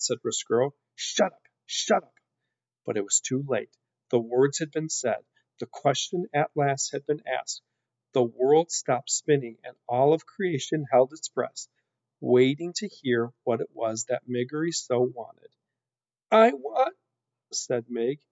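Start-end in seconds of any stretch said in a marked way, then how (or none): 1.0-2.1 higher, urgent silencing; agitated rat. A narrator's reading. 16.3-17.0 high, loud plaintive wail; girlish wail. A narrator's reading. Mig wails her wish.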